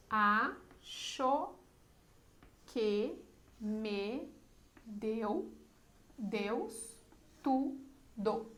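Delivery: "Acho que me deu Deus tudo" chopped into syllables, long pause after each; syllable-by-syllable scansion. Shown as a demonstration. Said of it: "Na escansão. Então, vamos lá". ("Acho que me deu Deus tudo" escandido sílaba por sílaba, com pausas)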